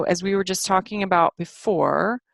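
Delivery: ordinary talk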